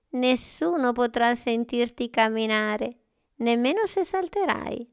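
put-on old woman voice. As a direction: play a sweet little old lady. Old woman thanks the boy. (voce da vecchina gentile)

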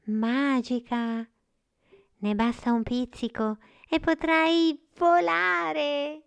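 high, loud little star, glowing with thanks; joyful high-pitched magical creature; grateful exclaiming star. A narrator's reading. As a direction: exclaim it high and drawn out, like a delighted fairy. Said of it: "brillando di gratitudine, esclamò".

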